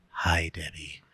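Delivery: hushed whispered greeting; match a soft speaker with a whisper. Retold or imitated. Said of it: "I tone matched you".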